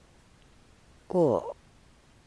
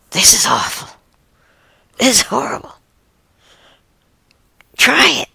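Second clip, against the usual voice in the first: disgusted cry climbing higher into an urging; disgusted raccoon; 9 semitones higher, 3 dB louder than usual. Acted. One raccoon urging the other to taste, disgusted. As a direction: play a grossed-out raccoon pushing a taste. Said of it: "the one raccoon says".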